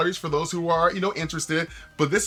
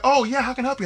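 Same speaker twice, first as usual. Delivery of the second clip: polite stranger; 11 semitones higher, faster than usual. Retold or imitated.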